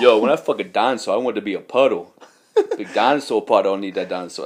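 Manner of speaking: loud, badly faked comedian impression; hint of a brash comic's voice; Tracy Morgan. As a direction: do a rough celebrity impersonation, loudly. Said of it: "worst Tracy Morgan impersonation ever".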